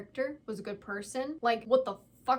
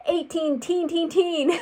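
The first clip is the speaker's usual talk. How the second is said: exasperated repeating; 6 semitones higher, 9 dB louder than usual. Real, not acted. Ranting about the age gap.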